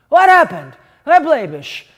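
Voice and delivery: high, loud frightened question; alarmed. Acted. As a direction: rush in panicked. Father runs in alarmed.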